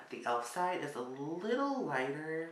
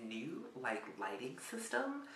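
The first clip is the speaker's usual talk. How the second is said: ordinary talk, nothing marked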